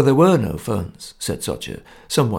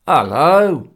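loud hello across the room; shouted greeting; 2 semitones higher, 5 dB louder than usual. Acted. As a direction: call out hello. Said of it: "shouted Gunnar Poulsen".

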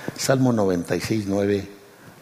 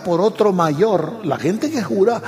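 much higher and louder, ringing out over the congregation; full-force preaching; 11 semitones higher, 4 dB louder than usual. Real, not acted. Preacher to his congregation on God swearing by himself, full-force shouted preaching. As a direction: preach it at the top of your voice.

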